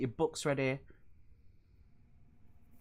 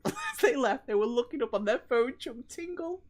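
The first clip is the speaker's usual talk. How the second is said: higher voice, fighting the giggles; words squeezed high by laughing; laughing retelling; 7 semitones higher than usual. Real, not acted. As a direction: tell it through rising laughter. Laughing while retelling the mishap.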